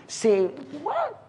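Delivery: high, soft rising disbelief; mock-incredulous query. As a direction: squeak it out as a doubting question. Acting out a doubter's disbelief.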